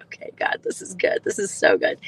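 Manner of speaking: soft, breathy thanks to God; whispered prayer. Real, not acted. Mother thanking God softly as her son heals.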